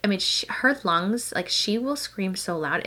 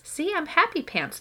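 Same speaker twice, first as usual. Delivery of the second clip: lightly voiced cheerful toddler; 10 semitones higher than usual. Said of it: "Mama, look".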